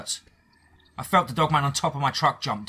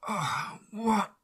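croaked; 10 semitones higher than usual. Acted. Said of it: "I croaked and wheezed".

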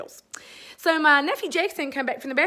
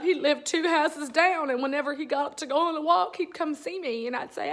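ordinary talk

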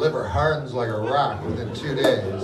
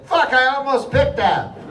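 higher, louder mock annoyance at the roulette table; mock frustrated exclaim; 11 semitones higher, 8 dB louder than usual. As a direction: play up the fake near-miss outburst. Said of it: "And then I say".

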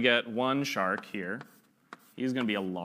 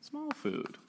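tiny squeaky voice; 9 dB quieter than usual. Pretend. Voicing tiny food in shark story.

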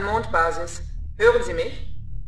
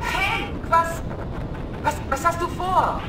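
surprised; 8 semitones higher than usual. Acted. Startled by being grabbed.

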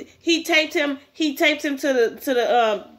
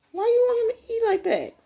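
very high-pitched, incredulous question; high exasperated outburst; incredulous protest; 10 semitones higher than usual. Real, not acted. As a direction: squeal it in disbelief at the story. Reader protests at bullying scene.

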